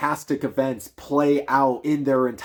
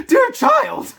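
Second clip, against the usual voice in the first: much higher and louder, a shocked mother's cry; horrified mother; 8 dB louder than usual. Acted. The mother, horrified at her daughter's words, high shocked cry. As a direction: let the horror burst out. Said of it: "Marie's mother was horrified".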